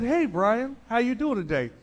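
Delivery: high, upbeat phone greeting; chirpy rep. Retold or imitated. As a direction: sound overly friendly on the phone.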